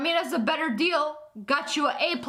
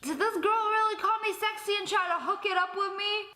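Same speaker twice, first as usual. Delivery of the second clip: mock teacher voice; 8 semitones higher, 3 dB quieter, faster than usual. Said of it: "he's gonna be like".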